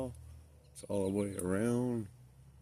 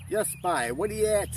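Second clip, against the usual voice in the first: loud, high Newfie holler; Newfoundland accent; 11 semitones higher, 9 dB louder than usual. Acted. Camper mimicking old hometown talk, loud Newfoundland accent. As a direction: call out in a broad Newfoundland accent. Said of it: "I don't talk like that anymore".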